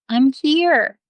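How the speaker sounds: high, mock holler from below; hollering back. Lost Jo answering her rescuer from the well, hollering back. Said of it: "and she hollers back".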